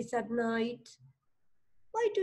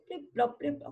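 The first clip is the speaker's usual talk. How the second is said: softer, short imitated raindrops; dripping noise; 4 semitones higher, 6 dB quieter, faster than usual. Shown as a demonstration. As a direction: drop each syllable like water falling. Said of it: "And that was a noise".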